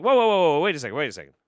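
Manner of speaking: sudden high loud whoa; retold shock at the gun store owner; startled whoa. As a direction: back off in alarmed surprise. Recoiling from owner's accusation.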